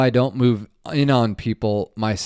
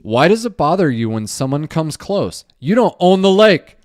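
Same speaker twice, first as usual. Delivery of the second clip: reading a comment; 6 semitones higher, 7 dB louder than usual. Retold or imitated. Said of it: "Ronson Skevington says".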